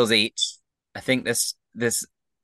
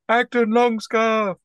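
high, drawn-out chant of a name; crowd cheering; 13 semitones higher, 4 dB louder, slower than usual. Acted. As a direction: cheer like a whole crowd hailing the winner. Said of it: "And everyone cheers".

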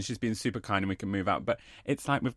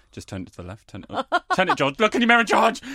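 calling out directions; 7 semitones higher, 5 dB louder, faster than usual. Retold or imitated. Directing George parking his car.

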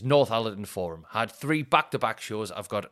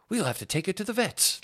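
higher-pitched mock gravity; serious dad; 10 semitones higher than usual. Dad deciding the dog needs the vets, mock seriousness. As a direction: lightly mimic a dad being really serious. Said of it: "Like, really serious".

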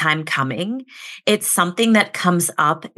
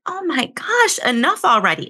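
much higher, fed-up outburst; exasperated; 8 semitones higher than usual. Retold or imitated. Host voicing the healing client's frustration, exasperated higher cry. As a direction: throw it up high like you've had it. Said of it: "you just feel like".

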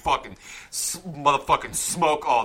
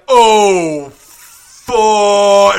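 loud, high, drawn-out cry of alarm; cut-off curse; 8 semitones higher, 14 dB louder than usual. Retold or imitated. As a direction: imitate a doomed turtle yelling before impact. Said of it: "right before I could finish the word fuck".

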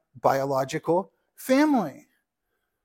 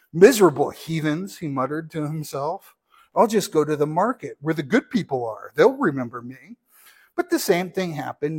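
lively read-aloud storytelling voice; story narration; 5 dB louder than usual. Acted. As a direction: read the parable to the congregation like a storybook.

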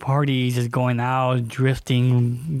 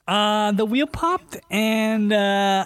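made-up excuse; 6 semitones higher, slower than usual. Retold or imitated. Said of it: "I had to make something up".

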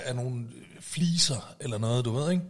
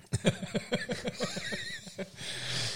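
long breathy giggling fit; helpless high, airy laughing; high wheezy laugh; 8 dB quieter than usual. Real, not acted. Laughs at his own remark.

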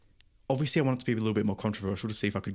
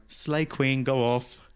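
mock sassy; 3 semitones higher, 3 dB louder, much slower than usual. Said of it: "I was replying being like".

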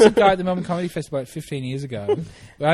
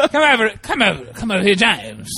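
a hint of an educated hobo, beckoning with a laugh; lightly voiced well-spoken hobo calling a friend over; John Conway voice; 4 semitones higher, 3 dB louder than usual. Retelling a friend's hobo bit, lightly voiced educated hobo. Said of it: "is the John Conway voice".

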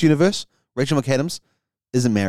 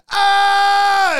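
startled scream; 6 dB louder than usual. Acted. Strange wakes beside Rob Schneider.

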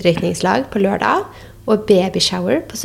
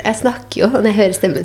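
normal talking voice

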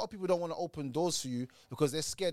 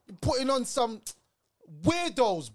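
raised, slow London roadman shout; loud call-out; 7 semitones higher, 5 dB louder, slower than usual. Real, not acted. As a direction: shout it out to the other podcast. Calling out to Fresh and Fit.

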